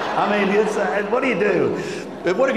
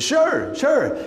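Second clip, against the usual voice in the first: 1 eager yes; 9 semitones higher than usual. Retold or imitated. Agreeing to kids' invitation.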